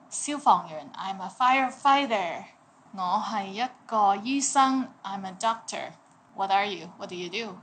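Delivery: ordinary delivery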